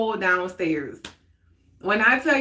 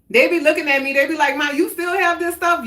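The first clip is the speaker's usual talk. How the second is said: put-on high, loud teasing kids; amused retelling; 7 semitones higher, 5 dB louder, faster than usual. Kids teasing mom, retold.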